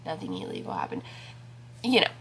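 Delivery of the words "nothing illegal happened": much lower, softer repeat of nothing illegal; low mumbled aside. Shown as a demonstration. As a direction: drop low and quiet, muttering it to yourself. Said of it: "Don't worry, nothing, like, illegal happened".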